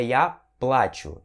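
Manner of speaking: плачу with a strong first syllable, PLA-chu; stress on the A. Shown as a demonstration. Teacher shows learners the correct pronunciation, stressed A in плачу. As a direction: lean hard on the A of плачу. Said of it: "sílaba tônica vai aqui no A".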